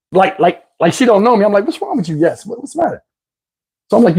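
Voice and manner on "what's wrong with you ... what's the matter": hushed puzzled question. Retold or imitated. Puzzled by girlfriend acting strange.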